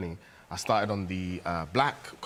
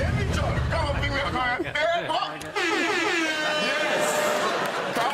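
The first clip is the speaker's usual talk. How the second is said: very high, loud hype shouts; hyping the crowd like a comedy-circuit DJ; hype man; 5 dB louder than usual. Acted.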